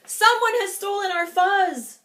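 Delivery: high, loud alarmed cry; cried out. Acted. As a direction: raise the alarm. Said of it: "cried Pipsqueak".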